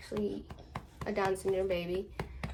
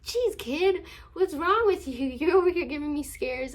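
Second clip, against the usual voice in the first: mock scolding; 7 semitones higher, 4 dB louder than usual. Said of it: "So I was like".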